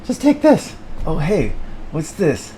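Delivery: high, whining loser voice; whiny high character. Acted. High whiny voice bemoaning the crowbar. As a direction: whine it like a hopeless failure.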